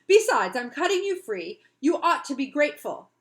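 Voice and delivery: put-on a child loudly demanding gratitude; indignant demand. Florrie insisting on gratitude.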